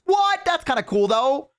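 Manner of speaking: very high, loud surprised squeak; surprised squeal. Real, not acted. Reacting to a chat story, high loud surprised squeak. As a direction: jump up in pitch, amazed.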